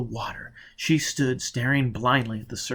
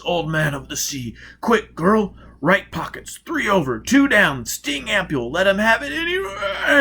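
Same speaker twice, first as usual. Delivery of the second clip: choked, rising higher and louder; strangled voice; 9 semitones higher, 7 dB louder than usual. Acted. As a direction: force the words out while being crushed. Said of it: "he said in a strangled voice".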